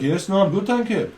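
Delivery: lightly voiced polite Brummie reply; Brummie accent. Lad answers the greeting, light mock Brummie accent. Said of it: "That's how they speak up in Brummie land".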